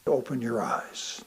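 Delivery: ordinary talk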